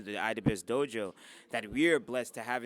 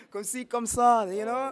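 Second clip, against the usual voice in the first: mock French; 11 semitones higher, 11 dB louder than usual. Joking about knowing French.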